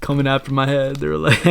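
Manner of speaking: ordinary delivery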